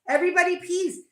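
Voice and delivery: usual speaking voice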